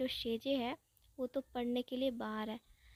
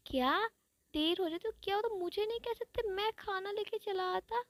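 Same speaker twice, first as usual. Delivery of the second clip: high-pitched hurt whine; high, petulant voiced friend; high whiny complaint; 8 semitones higher than usual. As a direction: whine like a miffed kid who wasn't told. Ibo complains he wasn't told.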